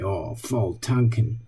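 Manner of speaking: low, soft and slow, a let-down sigh of a phrase; dismayed. Retold or imitated. Friends joking over a bad moment, deflated. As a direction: say "Oh, voll tanken" like something just went wrong. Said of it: "When something bad happened".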